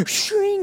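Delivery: slow, high drawn-out shing; playful mock sword effect; sword-drawing sound. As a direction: make the sound of a blade leaving its sheath. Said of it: "a sword out of a scabbard".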